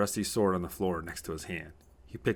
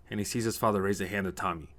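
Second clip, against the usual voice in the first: ordinary delivery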